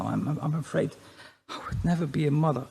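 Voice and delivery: ordinary talk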